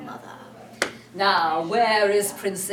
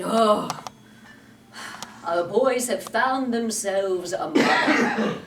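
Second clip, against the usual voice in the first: 1 Hook; 6 dB louder, slower than usual. Acted. Hook lamenting.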